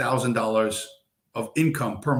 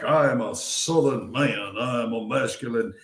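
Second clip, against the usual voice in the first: mock Southern accent. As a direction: lay on a Southern man's accent to mock his macho act. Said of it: "he's coming off like".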